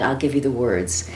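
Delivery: normal talking voice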